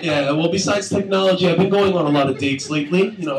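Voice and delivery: ordinary talk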